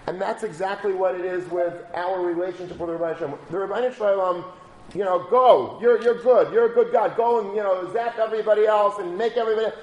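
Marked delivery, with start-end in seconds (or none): 5.3-9.7 loud, higher, rousing pep talk; pumped-up sendoff. Retold or imitated. Rabbi pushing a good student to go inspire everyone, loud and excited. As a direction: shout him on like a coach, fast at the end.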